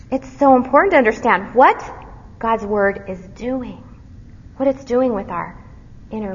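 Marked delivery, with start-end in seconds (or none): none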